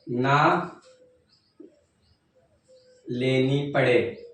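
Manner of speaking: slow, spaced out, pausing between words; unhurried teacher dictation, one chunk at a time. Shown as a demonstration. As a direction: dictate it so the class can repeat along. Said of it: "बोलिए मेरे साथ".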